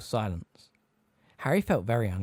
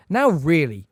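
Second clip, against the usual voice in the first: loud, fretful plea; a flustered examiner losing control; anxiously; 4 semitones higher, 9 dB louder, slower than usual. A narrator's reading. Examiner trying to regain the class, anxious and loud. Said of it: "said Professor Tofty anxiously".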